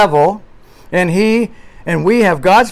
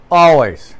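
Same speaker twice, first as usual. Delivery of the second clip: Always alone, louder, falling; stress on always; 4 dB louder than usual. Shown as a demonstration. Preacher to the church, stressing the word Always. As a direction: land hard on Always. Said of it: "Now, emphasis".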